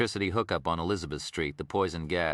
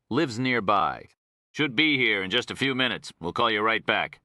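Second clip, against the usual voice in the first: higher, louder Texan greeting; Texas warden; 4 semitones higher, 4 dB louder than usual. Acted. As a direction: hail him in a folksy Texas accent.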